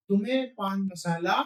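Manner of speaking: normal talking voice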